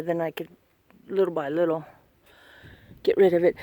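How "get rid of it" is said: normal talking voice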